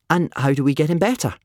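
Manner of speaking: quick, high, forced cheer; trying to sound cheerful. A narrator's reading. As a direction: perk up brightly to hide dread. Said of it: "he tried to sound cheerful".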